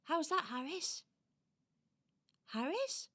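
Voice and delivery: high, questioning doll voice; checking little doll. Acted. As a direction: call lightly to see if he's asleep. Doll checks on sleeping hedgehog.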